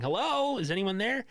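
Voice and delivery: high calling hello into the dark; a hint of a nervous woman calling out. Recapping Victoria calling into the building, lightly voiced. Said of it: "he hears Victoria going".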